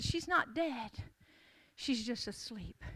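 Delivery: soft, low and slowing, almost to yourself; hushed assurance. Acted. Jesus telling the mourning crowd, softly and calmly. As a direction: let it fall gently into silence.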